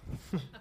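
breathy chuckle under the breath; a quick silent laugh; soft unvoiced laugh. Real, not acted. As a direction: laugh quietly to yourself. Laughs at own joke.

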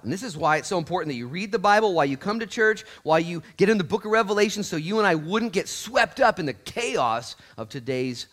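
loud, high, building testimony; full-force preaching. Real, not acted.

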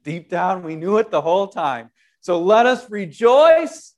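ordinary delivery

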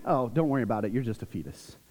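low, soft condescending brush-off; dismissive sisterly tease. Retold or imitated. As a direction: imitate an older sister's offhand put-down. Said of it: "so they'll say something".

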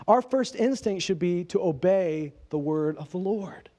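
ordinary delivery

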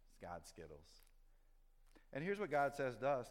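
normal talking voice

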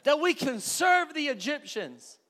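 high, loud, slow mocking whine; mock whiny Israelites. Preacher mocking the Israelites' complaint to Moses, high whine. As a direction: mimic the complaining people, drawn out.

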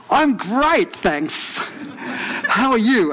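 high, over-bright mock-cheerful reply; faking cheerfulness. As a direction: put on a breezy front, pitch leaping up. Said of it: "So I'm playing the game".

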